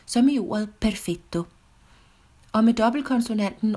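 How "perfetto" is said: perfetto with a held double t; Italian double consonant. Shown as a demonstration. Teacher shows the Italian double consonant, perfetto with long tt. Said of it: "altid til dobbeltkonsonant på italiensk".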